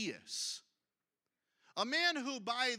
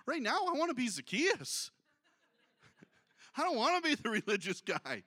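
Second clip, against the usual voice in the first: laughing; 4 dB louder than usual. Real, not acted.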